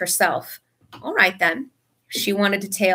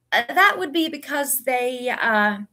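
hesitant; 4 semitones higher than usual. A narrator's reading. Lupin hesitates, clearing his throat.

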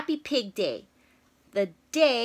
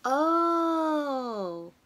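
usual speaking voice

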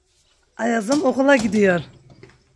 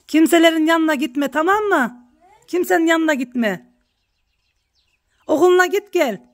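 higher, pleading mother's warning to her son; motherly warning; 7 semitones higher than usual. Retold or imitated. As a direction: urge the child to stay away from everyone. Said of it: "Ayaz'ımı tembihledim". (yüksek sesli, tembihleyen anne uyarısı)

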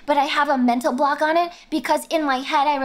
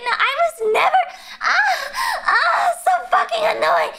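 loud, high-pitched protest, half laughing; exasperated outburst; 10 semitones higher, 4 dB louder than usual. Real, not acted. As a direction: wail it in exasperation, louder and much higher. Exasperated denial of being theater kid.

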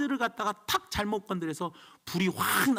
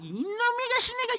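mock yelling father; 11 semitones higher, faster than usual. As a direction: play an angry dad scolding his daughter over her skirt, hammed up with a laugh. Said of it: "막 소리 내고 소리 지르고".

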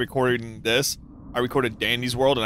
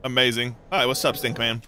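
ordinary delivery

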